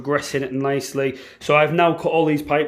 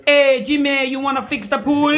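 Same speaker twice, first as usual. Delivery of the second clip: put-on Spanish accent; 10 semitones higher, 4 dB louder than usual. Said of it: "You speak Spanish accent".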